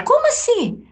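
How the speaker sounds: shocked, annoyed disbelief at the producer; indignant disbelief. Retold or imitated. Retelling the radio producer's call, shot up high in disbelief. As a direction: voice leaps high in retold outrage. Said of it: "meio chateada".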